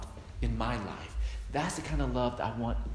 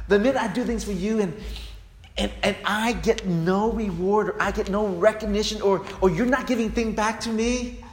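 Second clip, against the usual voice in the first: aggrieved complaint; 8 semitones higher, 5 dB louder than usual. Acted. Voicing self-serving churchgoer.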